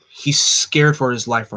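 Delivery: plain reading voice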